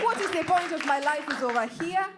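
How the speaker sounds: put-on high Kenyan-accented lament. Child despairing at the move to India, in a Kenyan accent. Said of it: "And that's how I was talking".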